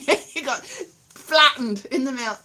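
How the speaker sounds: usual speaking voice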